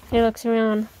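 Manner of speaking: usual speaking voice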